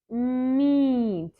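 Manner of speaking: quick and short; fast. Shown as a demonstration. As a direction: say Meet briskly. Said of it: "slow and then fast".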